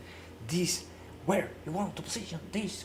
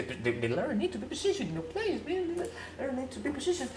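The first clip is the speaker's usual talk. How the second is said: ordinary delivery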